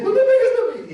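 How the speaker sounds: put-on high, pleading little-boy voice; child pleading. Preacher imitates his five-year-old son begging, high and pleading. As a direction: beg like a small child. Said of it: "Entonces, él".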